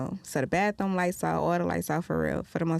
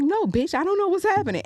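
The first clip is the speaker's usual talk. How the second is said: high, loud confused protest; retold freak-out, pitched way up; mock bewildered panic; 11 semitones higher, 5 dB louder than usual. Answering Wish while tripping on shrooms, mock bewildered panic. Retelling confused outburst to her friend.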